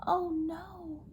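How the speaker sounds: plain reading voice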